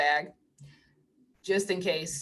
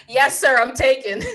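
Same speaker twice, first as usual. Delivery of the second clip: laughing reply; 3 semitones higher, 7 dB louder than usual. Retold or imitated. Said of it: "I said".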